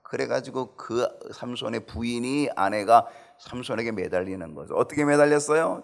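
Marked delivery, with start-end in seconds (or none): none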